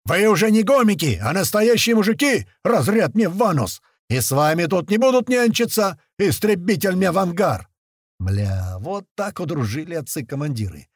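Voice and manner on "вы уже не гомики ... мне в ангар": high, loud commander's rant; yelled mock speech. A narrator's reading. Chief imagines addressing his men for a joke, yelling. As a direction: holler it like a parody army pep talk. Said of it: "так и хотелось заорать".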